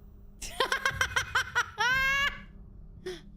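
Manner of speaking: high, long burst of cackling laughter; laughing. Real, not acted. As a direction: laugh hard in a high, rapid run. Laughing at chat remark.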